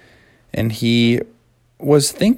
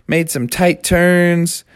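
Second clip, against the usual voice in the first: ordinary delivery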